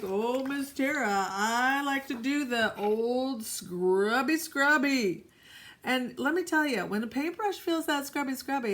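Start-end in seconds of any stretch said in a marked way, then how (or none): 0.0-5.3 slow, drawn-out artist voice; mock young artist. Artist telling teacher about scrubbing, slow put-on voice. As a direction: drag it out as an eager scrubber. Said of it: "some artists are like".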